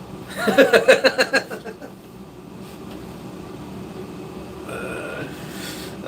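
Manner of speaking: high, long laughing fit; helpless cackling; real laugh. Real, not acted. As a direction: burst out high, then run out of air. Cracks up at own story.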